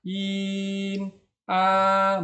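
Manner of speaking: IN and A long, high and level; first tone. Shown as a demonstration. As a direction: hold IN, A on one high note. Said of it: "Ini bunyi pertama". (IN, A nada pertama, tinggi datar)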